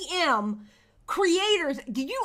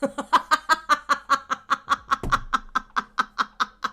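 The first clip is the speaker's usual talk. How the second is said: long laugh. Real, not acted. Streamer cracking up at a chat joke, laughing hard. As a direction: crack up for real.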